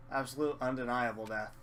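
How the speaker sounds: normal talking voice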